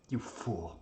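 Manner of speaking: heavy sigh, then subdued rebuke; weary reproach. Acted. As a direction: let the anger drain out in a sigh. Anger gives way to sigh.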